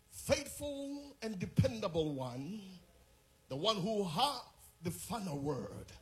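building fervent prayer, rising to loud, high shouts; rousing prayer from calm to full-throated praise; preaching prayer. Acted. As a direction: pray with mounting fire until it rings out.

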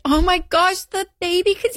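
high, squealing mock gush over the house; imagine the baby here, squeal it; gushing excitement. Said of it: "I was like".

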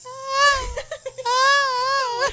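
very high, drawn-out mock scream; background scream. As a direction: imitate distant screaming, long and high. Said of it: "in the background".

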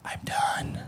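hushed, spent mimicry of a wife; soft, acted surrender; exhausted whisper. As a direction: whisper it as if at the limit. Acts exhausted wife giving up.

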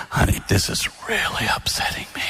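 whispered, restrained upset; hushed, controlled complaint to a spouse; half-tone hush. Retold or imitated. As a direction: confide it in an acted whisper. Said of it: "lower it to half your normal volume".

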